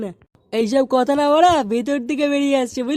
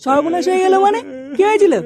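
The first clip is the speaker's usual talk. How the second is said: startled demand for what happened; alarmed; 5 semitones higher, 3 dB louder than usual. Acted. Boss startled by the shopkeeper's wailing news, alarmed. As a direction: pitch jumps up, louder, alarmed.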